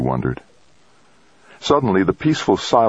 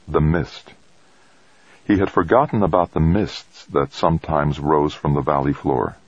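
plain reading voice

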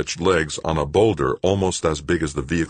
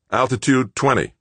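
tense copilot; 9 semitones higher, 4 dB louder than usual. A narrator's reading. Killefer calling altitude.

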